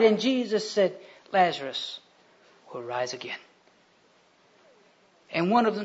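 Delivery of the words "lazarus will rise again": low, soft, measured promise; calm assurance. Acted. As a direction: answer the mourners quietly and surely. Jesus calmly answers weeping sisters.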